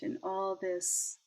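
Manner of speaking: ordinary talk, nothing marked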